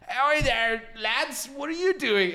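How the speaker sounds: put-on high, loud, slow drunken call to the lads; drunk chatty greeting. Greeting two British guys at the concert, high loud drunk voice. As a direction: boom it out like a tipsy new best friend. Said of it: "and I'm like".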